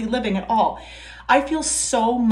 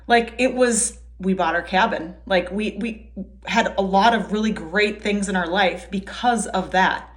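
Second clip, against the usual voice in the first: ordinary talk, nothing marked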